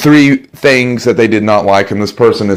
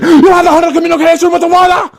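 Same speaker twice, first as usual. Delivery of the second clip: furious German shout; 4 dB louder, faster than usual. Acted. Salesman turns on the customer.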